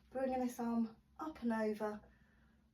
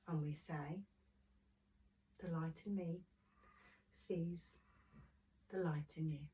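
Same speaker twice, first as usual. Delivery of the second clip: guided relaxation; 7 dB quieter than usual. Acted. Guided relaxation closing the class.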